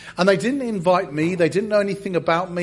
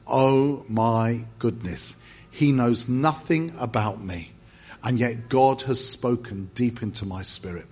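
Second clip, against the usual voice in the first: low, awed inner voice; stunned awe; 7 semitones lower than usual. Retold or imitated. Stunned visitor thinking over the prophecy, awed. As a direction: let it sink in, low and amazed.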